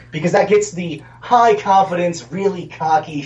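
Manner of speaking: normal talking voice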